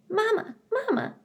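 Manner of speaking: very high little gosling voice; a baby bird calling its mother, high and small; gosling chirping. A narrator's reading. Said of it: "Yes, Bright Bill".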